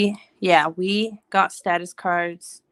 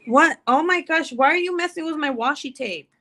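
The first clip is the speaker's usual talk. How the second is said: raised, incredulous parent voice; higher, exasperated fussing at a little one; playful scolding; 7 semitones higher than usual. Real, not acted. Mom catches her toddler messing with washi tape, scolding.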